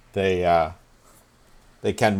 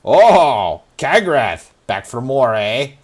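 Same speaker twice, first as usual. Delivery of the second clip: louder, higher sleazy promoter voice; promoter greeting; 4 semitones higher, 7 dB louder, much slower than usual. Acted. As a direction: greet her like an old regular. Promoter greeting returning fighter.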